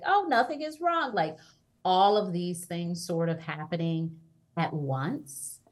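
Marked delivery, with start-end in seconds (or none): 0.0-1.1 mock denial. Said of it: "someone's like".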